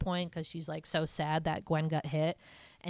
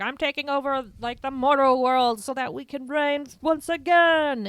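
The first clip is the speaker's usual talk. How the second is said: grand hammed-up villain declaration, higher and louder; mock villain; 7 semitones higher, 7 dB louder than usual. Podcaster imitating the movie villain, hammed-up and booming. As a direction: ham up the evil overlord's boast.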